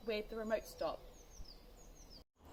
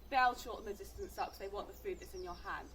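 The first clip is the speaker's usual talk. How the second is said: ordinary talk, nothing marked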